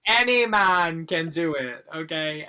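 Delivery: booming announcer slogan, then trailing off; mock ad voice. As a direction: sell it like a cheesy commercial.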